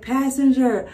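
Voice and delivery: drawn-out mock megaphone order; cop on microphone. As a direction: imitate a cop ordering over a loudspeaker. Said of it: "The cop says on his microphone".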